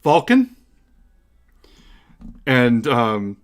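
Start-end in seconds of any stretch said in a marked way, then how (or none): 0.0-0.6 higher, louder questioning call. Acted. Goddess hails the smith through a portal, calling out. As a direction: call through to someone on the other side.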